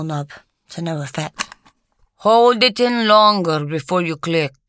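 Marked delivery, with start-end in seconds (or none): 2.2-4.6 put-on louder instruction in a faded Polish accent; lightly Polish-accented old pipe smoker. A narrator's reading. Old man instructing Lucy at the fire, faded Polish accent. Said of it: "instructed in his faded Polish accent".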